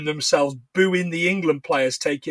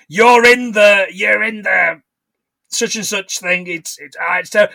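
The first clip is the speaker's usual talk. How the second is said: loud, hammed-up London accent; mock London voice; 2 semitones higher, 4 dB louder than usual. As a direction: put on a broad London accent, starting loud. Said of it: "My London accent".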